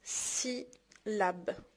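"سيلاب" in two slow, separated beats; syllable by syllable. Shown as a demonstration. Teacher showing what a syllable is, syllable by syllable. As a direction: softly split "سيلاب" with a long gap between syllables. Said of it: "تقطع الكلمات كيتسمى سيلاب".

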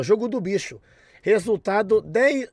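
ordinary talk, nothing marked

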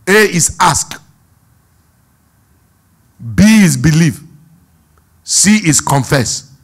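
the preacher presses his point on the congregation at the top of his voice; preaching at full force. Real, not acted. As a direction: drive the point home to the crowd.

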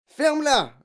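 loud, high furious command; father yelling in anger; angry shout. A narrator's reading. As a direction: shout the child silent. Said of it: "cria son père".

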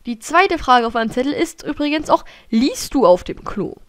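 usual speaking voice

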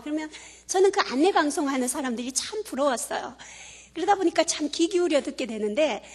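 ordinary talk

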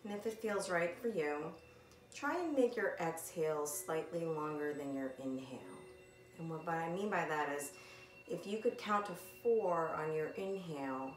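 calm, softened meditation guide voice; gentle, unhurried breathing instructions with long pauses; guided meditation. Acted. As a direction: ease them into the breath, leaving long silences. Leads a body scan meditation.

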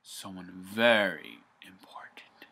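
hushed dramatic whisper, slow. Real, not acted. Teasing the audience in a slow dramatic whisper. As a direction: whisper it like a big secret. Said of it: "I am gonna talk about".

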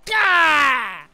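sharp loud startled gah. Real, not acted. Gamer startled by something on screen, loud gah. Sudden shout in game.

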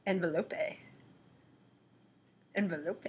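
put-on South American accent. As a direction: try a South American-sounding "Envelope". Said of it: "I'm not South American".

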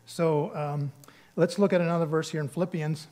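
usual speaking voice

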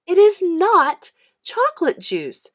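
high, loud, slow correction; stubborn little girl, high and loud; indignant little sister. A narrator's reading. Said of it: "she said".

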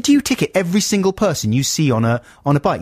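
mildly outraged retort; mock incredulous. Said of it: "I said".

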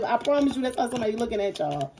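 ordinary talk

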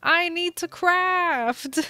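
high, insistent, drawn-out orders; exasperated demand. Real, not acted. Yelling at Kat to move from the table, insistent and high. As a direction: push through the annoyance, make them move.

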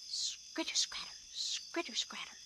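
whispered scurrying scritter scratter. A narrator's reading. Narrator whispering the squirrel on the roof. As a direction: breathe it like tiny claws.

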